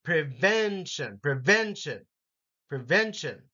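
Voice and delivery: pre-VEN-tion, middle syllable hit; prevention, VEN stressed each time; second syllable stress. Shown as a demonstration. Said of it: "have second syllable emphasis".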